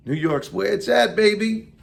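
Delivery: hyped up. Real, not acted. Hyping the city.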